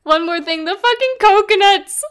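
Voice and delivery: loud, high burst of delight; excited outburst. Real, not acted. Friend adding a favourite film bit, loud and excited. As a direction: blurt it out, thrilled.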